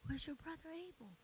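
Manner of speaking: soft breathy whisper of God's question; whispered gentle question, God asking daily. Retold or imitated. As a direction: hush it almost to nothing.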